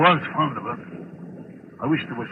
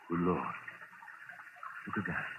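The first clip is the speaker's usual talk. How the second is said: low, hushed and stunned, much softer; hushed shock; 7 semitones lower, 17 dB quieter than usual. Acted. Boss, stunned by his wife's grave, hushed and shaken. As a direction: breathe it out as if seeing a ghost.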